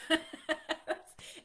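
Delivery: loud burst of laughter; sudden high, loud laugh. Real, not acted. Laughs at her own small struggles.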